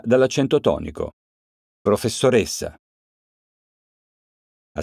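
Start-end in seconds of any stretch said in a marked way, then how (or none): 1.8-2.8 Professoressa with the E of ressa leaned on; stress on the E. Shown as a demonstration. Teacher demonstrating the stress to pupils, stress on E. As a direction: lean on the E of Professoressa, let the rest fall. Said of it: "la voce cade sulla vocale E".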